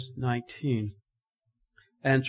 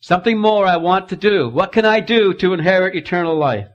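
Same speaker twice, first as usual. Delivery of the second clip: eager ruler; 7 semitones higher, 11 dB louder than usual. Acted. Ruler pleading with Jesus.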